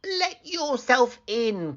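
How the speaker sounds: wolf faking grandmother; wolf as grandmother calling. Acted. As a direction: call out as the fake granny. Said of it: "called the wolf".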